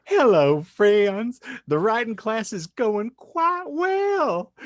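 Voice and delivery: sweetly pious housewife reading her letter; proud housewife. Acted. Podcast host reads the proud housewife's letter, high and prim. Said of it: "catching up from our, uh, friendly neighborhood proud mother and proud housewife".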